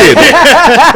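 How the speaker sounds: high, loud burst of laughter; real loud laugh. Real, not acted. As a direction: crack up loudly. Laughing at own story.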